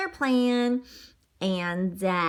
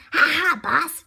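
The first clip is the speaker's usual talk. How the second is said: louder, higher mock chuckle; chuckling; 6 semitones higher, 5 dB louder than usual. Wolf chuckling to his boss, a hammed-up laugh. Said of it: "chuckled Sizzle".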